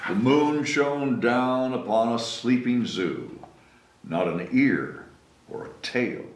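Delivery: ordinary delivery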